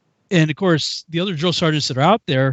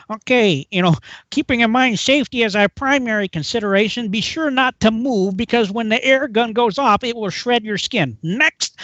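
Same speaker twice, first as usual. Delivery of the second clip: imitating a droning safety briefing, a little higher; funny-talking instructor; 6 semitones higher than usual. Medic briefs recruits on air gun safety, funny voice. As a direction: reel off the briefing in a funny put-on voice. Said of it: "He talked kind of funny".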